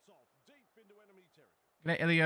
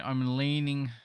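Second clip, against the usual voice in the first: "leaning" drawn out slowly and louder; stress on leaning; 3 semitones higher, 5 dB louder, much slower than usual. Real, not acted. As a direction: lean hard on "leaning", stretching it out. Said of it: "emphasis on the leaning".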